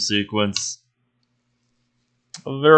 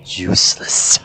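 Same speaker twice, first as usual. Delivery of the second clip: low, slow, half-whispered taunt; a dark voice in his head, lightly voiced; accusing inner voice; 3 semitones lower, slower than usual. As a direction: creep into his head and blame him. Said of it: "the voice stops".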